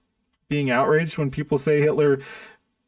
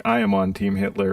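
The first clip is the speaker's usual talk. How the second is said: much lower mock voice for the quote; mock declaration; 11 semitones lower than usual. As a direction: drop the pitch as if voicing someone else. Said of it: "I would have to say something like".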